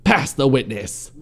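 roared. A narrator's reading. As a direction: roar it slowly for dramatic effect.

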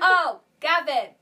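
loud, high Welsh catchphrase; exaggerated Welsh greeting; mock Welsh accent. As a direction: holler it like a Welsh sitcom character. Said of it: "Try and quote Gavin's days".

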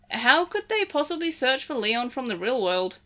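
plain reading voice

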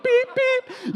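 very high, electronic-style checkout beeps; scanner beep imitation. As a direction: mimic a shop scanner beeping twice.

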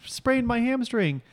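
high-pitched, drawn-out fake complaint; mock dismay. Trainer faking a hamstring sprain for her sake, mock dismay. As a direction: feign an injury in a high voice. Acting out a feigned injury.

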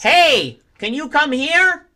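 high, loud, slow odd voice; David Lynch. Acted. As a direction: call out in a strange high voice. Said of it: "David Lynch going to".

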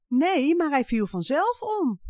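put-on high, loud child's yell; shouting child. A narrator's reading. Janneke yells back at Jip over the fallen tower, high and loud. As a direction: yell back like a stubborn girl. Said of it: "Waarom gillen jullie zo".

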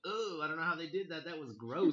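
creeped-out "ooh" reaction; grossed out. Retold or imitated. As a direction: recoil a little as you retell it. Retelling a disgusted reaction to the film.

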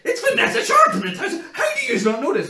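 high, loud incredulous yell; exasperated laughing shout; excited shout. Retold or imitated. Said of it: "I was like".

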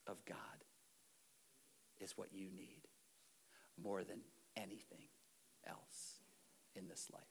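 Jesus gently correcting, whispered; hushed gentle Jesus. Acted. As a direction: draw them in with a near-whisper.

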